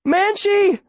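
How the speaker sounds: very high urgent call; yell. A narrator's reading. Girl calling dog back to boat, high urgent yell. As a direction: yell the name high and long. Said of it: "Viola yells".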